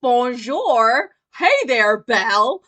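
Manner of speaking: mock French accent, loud and cheery. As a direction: greet Belle in a cartoon French accent. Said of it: "everyone's like".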